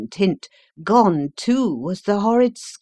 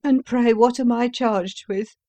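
prisoner's voice, higher; 6 semitones higher, 4 dB louder than usual. A narrator's reading. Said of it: "said he".

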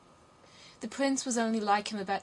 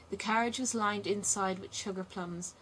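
ordinary delivery, nothing marked

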